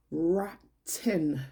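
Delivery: "rotten" breathed out unvoiced, falling; whispered "rotten". Real, not acted. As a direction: hiss "rotten" for emphasis. Stressing how spoiled siblings are.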